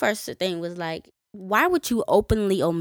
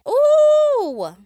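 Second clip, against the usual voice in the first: ooh; 8 dB louder than usual. Real, not acted. Reacting to abuse story.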